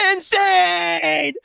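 high, stretched-out cartoon shriek; sudden high-pitched mock scream; mock insane wail. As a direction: cry it out like a hammed-up breakdown. Mock outburst after calm remark.

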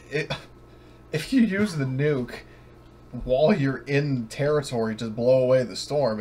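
normal talking voice

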